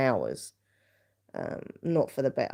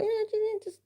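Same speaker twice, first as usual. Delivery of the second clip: high coaxing. Retold or imitated. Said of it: "I was like".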